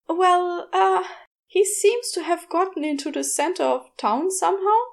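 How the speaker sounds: a flustered shopkeeper calling a customer; young shop woman. A narrator's reading. Shop girl Kathy phones Aziraphale about his snake, hesitant.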